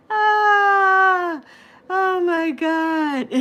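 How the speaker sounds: high laughter trailing into oh my God; laughing helplessly at the chat; laughing fit. Real, not acted. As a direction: let the laugh carry the words.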